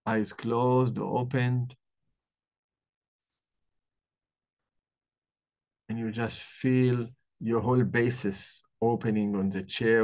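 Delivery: calm guided breathing meditation. Acted. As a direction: ease them into the breath, gently.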